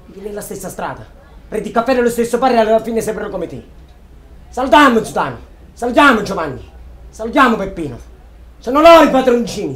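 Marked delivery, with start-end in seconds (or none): 1.5-9.7 mocking anger. Mocking angry rant to brother.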